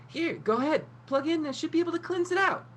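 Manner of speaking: very high, quick eager chirp; chirpy helper offering; bubbly young hacker voice; eager girl. Acted.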